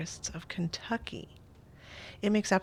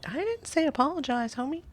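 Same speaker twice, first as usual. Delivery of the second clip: indignant protest; 9 semitones higher than usual. Real, not acted. Player protesting the apology the game picked, indignant and pitched up. Player objects to the game's choice.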